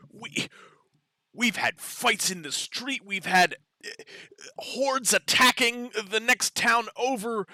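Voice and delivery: loud, high, accusing rant with weary sighs; angry townswoman. Acted. The human head scolds the party for the town's losses, angrily. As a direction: berate the outsiders for ruining the town. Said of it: "the human head says".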